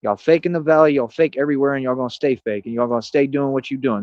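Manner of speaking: ordinary delivery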